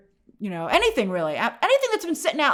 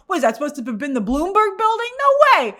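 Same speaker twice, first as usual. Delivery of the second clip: incredulous; 6 semitones higher, 4 dB louder than usual. Retold or imitated. Disbelief at the fake location.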